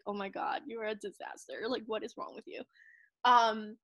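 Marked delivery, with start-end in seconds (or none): 0.0-2.6 retold disapproval. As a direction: drop very soft, mock dismay. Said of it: "my husband's like".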